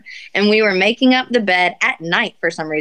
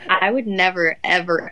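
ordinary talk

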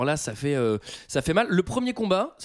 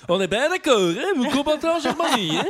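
loud, high mock Jura accent; 9 semitones higher, 4 dB louder than usual. Man showing off his regional impression, Jura accent. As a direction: put on a broad Jura accent. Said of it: "Je vais super bien le jurassien".

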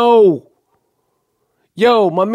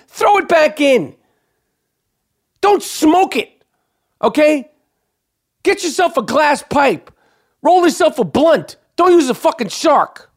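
high-pitched, indignant telling-off; exasperated scolding; 10 semitones higher than usual. Real, not acted. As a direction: lecture the fisherman in exasperation, voice pitched way up. Scolding the baby shark fisherman.

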